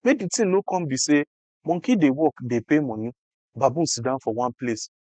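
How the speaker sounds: ordinary delivery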